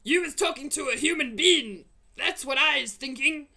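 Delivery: loud, high accusing roar; accusing giant. Acted.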